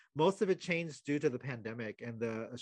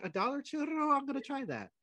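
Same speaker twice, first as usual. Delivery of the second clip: excited; 11 semitones higher than usual. Retold or imitated. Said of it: "So you'd be like".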